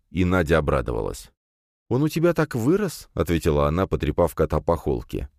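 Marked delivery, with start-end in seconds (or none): 1.9-3.1 put-on admiring girl's voice. A narrator's reading. Nadya admires cat.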